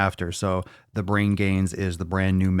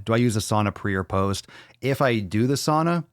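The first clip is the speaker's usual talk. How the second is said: ordinary delivery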